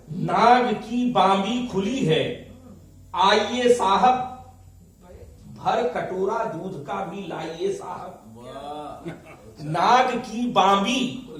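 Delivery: slow, drawn-out verse recitation with lifted pitch; poetry recital. Acted. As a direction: declaim the couplets slowly to a live audience. Recites a poem to audience. (धीमे, खिंचे स्वर में कविता पाठ)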